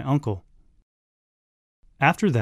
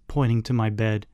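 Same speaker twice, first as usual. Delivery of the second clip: usual speaking voice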